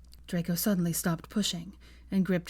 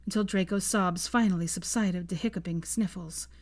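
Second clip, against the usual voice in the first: usual speaking voice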